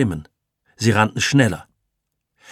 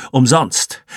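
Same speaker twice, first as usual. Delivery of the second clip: plain reading voice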